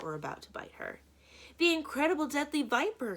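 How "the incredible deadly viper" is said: high, loud alarmed cry; a boy yelling at the sight of a snake; Klaus cries out. A narrator's reading. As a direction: shout the warning in alarm. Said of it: "Klaus cried".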